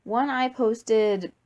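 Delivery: plain reading voice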